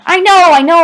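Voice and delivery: high, loud, overjoyed insistence; breathless relief at high pitch; excited shouting. A narrator's reading. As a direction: brush it aside, elated. Still shouting in relief at father.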